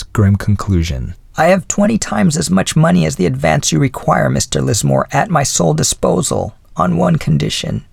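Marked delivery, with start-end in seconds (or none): 1.4-7.8 put-on lightly raised voice of a gracious old lady; a hint of the old widow's voice; elderly lady voice. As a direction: speak as a kindly older woman. Mrs Callender's offer.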